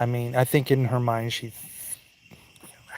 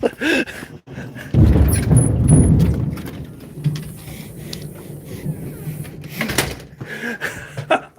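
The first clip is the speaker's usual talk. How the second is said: very high, long laughing fit; hysterical laughter; 6 dB louder than usual. Real, not acted. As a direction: crack up completely. Long laugh after lightning.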